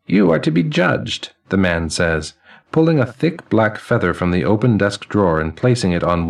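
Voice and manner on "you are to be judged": put-on high, ringing elderly man's voice; chiming old man. Elderly man greeting the newly dead, high and chiming. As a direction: chime each word like silver cymbals and laughter.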